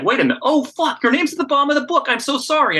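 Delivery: high, fast mock fan gushing; flustered apology. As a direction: squeal it like a fan caught out. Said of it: "And she's like".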